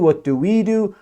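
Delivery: ordinary talk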